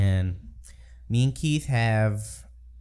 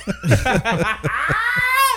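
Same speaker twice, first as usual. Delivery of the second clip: shrieking wheeze of a laugh; high burst of laughter; 14 semitones higher than usual. Real, not acted. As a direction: crack up hard and high.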